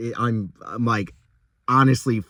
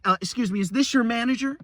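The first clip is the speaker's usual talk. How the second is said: higher, snooty imitation of a rude customer; mock entitled customer; 9 semitones higher, faster than usual. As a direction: mimic her demanding the manager. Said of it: "and was like".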